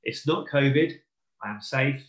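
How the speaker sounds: usual speaking voice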